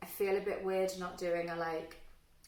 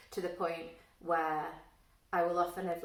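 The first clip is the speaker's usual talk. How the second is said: ordinary talk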